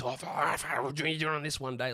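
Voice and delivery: slow, exasperated fan voice; mock angry fan. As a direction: play a ranting fan on Twitter. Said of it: "out there rage tweeting athletes".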